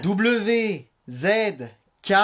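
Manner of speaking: letters spelled out slowly, with pauses; slow, separated W, Z, K; slowed dictation. Acted. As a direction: dictate one letter at a time. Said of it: "moins vite".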